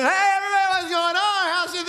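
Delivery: the careless walk-through before the quiet one; loud breezy greeting. Shown as a demonstration. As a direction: breeze in calling out to everybody. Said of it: "You're quiet".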